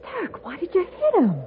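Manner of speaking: high, anxious cry of disbelief; shocked alarm. Acted. Wife horrified that her husband struck the stranger, high alarmed. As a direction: she can't believe what he just did.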